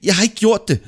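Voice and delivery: high, loud shouted refusal; defiant high-pitched cry; stubborn denial. Acted. Drowning man stubbornly denies the deed.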